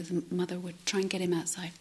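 ordinary talk

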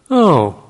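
"Oh" starts high and loud, then sinks; disappointed falling tone. Shown as a demonstration. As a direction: let "Oh" drop in mock disappointment. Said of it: "Falling tone to us is disappointment".

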